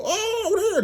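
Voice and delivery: high, drawn-out "Oh" in an imitated Southern accent; mock Southern accent. Imitating a storyteller performing Sojourner Truth's monologue, mock Southern accent. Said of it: "the Southern accent".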